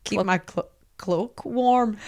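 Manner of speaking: soft, halting try at Northern Irish; tentative Northern Irish imitation; Northern Irish accent. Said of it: "He actually is Northern Irish".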